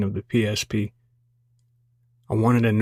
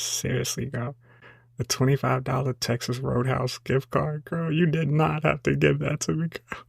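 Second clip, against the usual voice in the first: mock tearful gushing; 3 semitones higher than usual. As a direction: gush like you're about to cry, half-joking. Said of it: "I'm gonna start crying".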